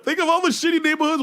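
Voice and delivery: can't get it out for laughing. Real, not acted. Retelling about his resort, laughing.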